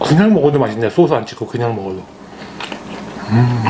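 talking while chewing; speaking around food; mouth full. Real, not acted. Seen 1: Bites pork knuckle earlier, cheeks bulge chewing during words.

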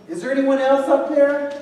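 high, loud call upward. Acted. Man on the cliff seeking other help, calling out. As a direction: raise it high and loud for someone far above. Man calls up for someone else.

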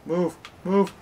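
real gamer yell; shouting. Real, not acted. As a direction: yell it in frustration. Shouting at the game.

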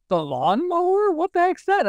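high, baffled double-take on the poster; puzzled surprise. Retold or imitated. Shopper reading the strange ad, high and baffled. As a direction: squint at it like it makes no sense.

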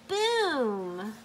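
ordinary delivery, nothing marked

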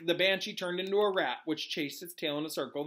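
ordinary delivery, nothing marked

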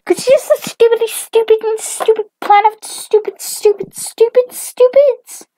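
very high, loud, rapid-fire tirade; shrieky cartoon mom, hammering every insult; hateful mom rant. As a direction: rant on and on in a squeaky put-on voice. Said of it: "her mom is still going".

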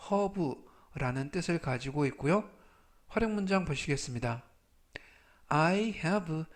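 usual speaking voice

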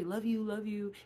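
quick, tossed-off love you with no I; rushed love you. Shown as a demonstration. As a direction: rattle off love you love you carelessly. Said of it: "Put the I in front of it. See how fast you say it then".

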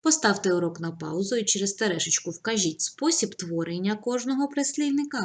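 ordinary talk

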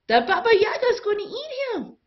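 very high, loud and fast alarm; panicked cry. A narrator's reading. A villager warning the crowd, high panicked yell. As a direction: blurt it out in panic. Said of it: "A voice from the crowd said".

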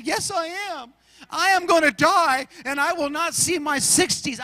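mock tearful fear. Pastor imitating the terrified flyer insisting she'll die, tearful. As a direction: whimper it like she's sure she'll die. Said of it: "she was so scared".

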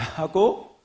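usual speaking voice

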